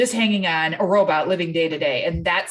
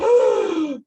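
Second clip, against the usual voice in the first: a sharp, startled intake of breath; shocked gasp; 9 semitones higher, 4 dB louder than usual. Retold or imitated. Mother hearing another mom insult herself, sharp shocked gasp. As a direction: gasp at what the mom just said. Mom's self-talk in front of kids.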